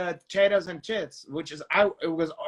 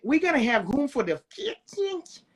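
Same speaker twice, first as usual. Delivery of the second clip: high-pitched mock impression; imitating his fixings line; 5 semitones higher than usual. Retelling the concept meeting to the co-host, high impersonation. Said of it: "And you gonna ha".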